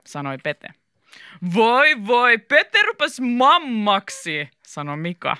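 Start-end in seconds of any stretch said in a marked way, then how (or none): 1.5-4.5 loud, drawn-out mock pity; mocking teasing. A narrator's reading. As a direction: jeer at a friend, high and slow. Mika mocks Pete in the story. (pilkallisesti ivaillen)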